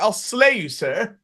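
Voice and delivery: fake English accent. Would-be robber disguising himself to the clerk, fake English accent. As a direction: put on a hammy English accent. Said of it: "a fucking English accent".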